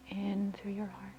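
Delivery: soft whisper, slow and drawn out; whispered breath cue. Acted. Guiding the listener's inhale through the heart, whispering.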